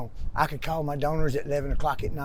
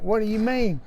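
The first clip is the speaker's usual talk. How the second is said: pitched up, bewildered question; puzzled surprise; 6 semitones higher than usual. Retold or imitated. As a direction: act like you can't believe it. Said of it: "And I'm like".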